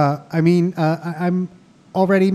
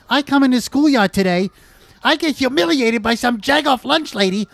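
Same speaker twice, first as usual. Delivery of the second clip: indignant rant; 6 semitones higher than usual. Acted. Acted schoolyard quarrel, rant.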